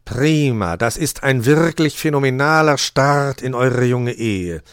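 loud, stately raven voice; congratulating. A narrator's reading. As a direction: congratulate them slowly and grandly. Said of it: "beglückwünschte der Rabe die Brautleute".

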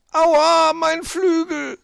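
hoher, lauter, gedehnter Aufschrei; Schmerzensruf. A narrator's reading. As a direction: jaul auf vor Schmerz, ganz hoch. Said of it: "rief er". (vor Schmerz aufschreien)

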